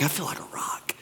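whispered, private confession; hushed self-belief. Acted. Peter quietly owning what Jesus called him, whispered. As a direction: drop to a soft whisper.

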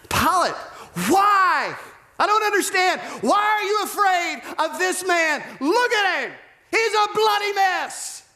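very high, strained yelling plea; pleading shout. Acted. Pilate to the crowd over a wounded man, shouted pleading. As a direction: cry out in disbelief at the mob.

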